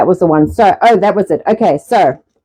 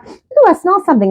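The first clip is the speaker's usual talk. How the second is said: higher-pitched curious shark; lightly voiced shark; 10 semitones higher than usual. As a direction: sniff out a snack with interest. Voices sharks scenting the chum.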